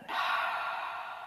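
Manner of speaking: long soft sigh letting the breath go; audible exhale. Shown as a demonstration. Teacher guiding the class breathing, soft sigh.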